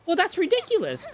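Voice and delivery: high indignant customer scoff; mock karen voice. Imitating the angry customer scoffing at the line, high and indignant. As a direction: scoff up high like an entitled shopper. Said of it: "And she goes".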